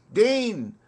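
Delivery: high, loud call across the camp. Acted. Soldier calling the water carrier across camp, loud call. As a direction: yell the name to fetch him.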